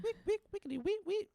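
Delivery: very high sing-song chant; mock incantation. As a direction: squeak out a garbled spell. Mimicking Maggie's incantation.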